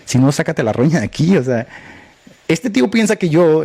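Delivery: normal talking voice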